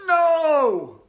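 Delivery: high, very loud shout; boy refusing at the altar. Acted. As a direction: yell with everything you have. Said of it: "I shouted as loud as I could".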